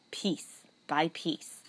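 slow menacing whisper. Acted. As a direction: breathe it slowly, threateningly. Menacing jailer to mouse.

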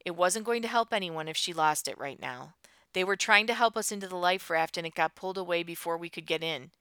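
ordinary delivery

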